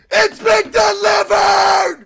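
high, loud, drawn-out announcement; triumphant shout. Real, not acted. Player announcing the delivery is made, triumphant shout. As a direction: yell it slowly in triumph.

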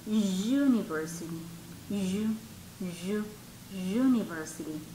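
"University" said low and soft, opening on a held "yu"; consonant-like "yu" onset. Shown as a demonstration. Teacher has students listen to how university starts, low "yu" demonstration. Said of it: "¿cuál es su pronunciación? Escuchen".

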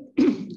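throat clear before talking; rough clearing of the throat. Real, not acted. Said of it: "I'm sorry, morning vo".

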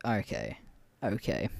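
low, quiet resigned "okay"; underwhelmed. Retold or imitated. Reacting to her one-word text, underwhelmed. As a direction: let it sink, deflated. Acting out underwhelmed reply to match.

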